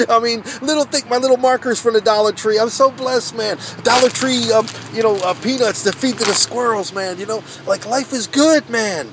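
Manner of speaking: 笑いながら高く大きな声ではしゃぐ; 小さな恵みに心から喜び、笑い声まじりで弾む; excited laughing. Real, not acted. Joyful about Dollar Tree blessings.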